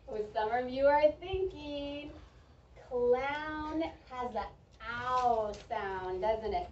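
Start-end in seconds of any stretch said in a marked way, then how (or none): 2.9-6.2 slow, stretched teacher demo on Clown; drawn-out vowel demo. Shown as a demonstration. Teacher contrasting sounds for first graders, slow and stretched. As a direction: draw out Clown so the ow sound is clear. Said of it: "listen for the ah sound".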